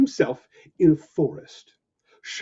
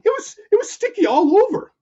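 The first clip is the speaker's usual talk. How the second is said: panicky; 5 dB louder, faster than usual. Acted. Trapped in web, panicking.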